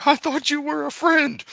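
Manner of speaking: high, hurt mock plea; hurt falsetto. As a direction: whine it like a betrayed friend. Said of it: "troll me".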